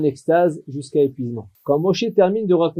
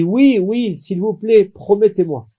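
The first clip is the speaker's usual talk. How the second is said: higher and louder pleading cry; 4 semitones higher, 3 dB louder, slower than usual. Acted. Son begging the rabbi for a promise, raised pleading cry. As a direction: beg him urgently, voice raised. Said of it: "Et là, je crie".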